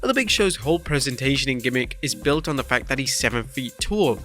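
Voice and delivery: ordinary delivery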